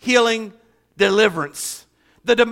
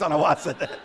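usual speaking voice

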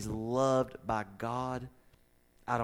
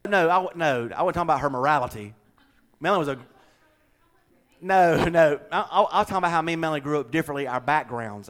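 loud, higher, hurried protest; raised voice, rushing to clarify; flustered correction; 4 semitones higher, 9 dB louder than usual. Real, not acted. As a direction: jump in quickly to set the record straight.

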